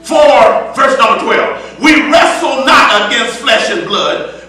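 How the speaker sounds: full-voiced preaching with rising fervor. Preacher exhorting the congregation in full preaching voice. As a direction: preach it out to the church.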